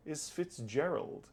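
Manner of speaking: ordinary delivery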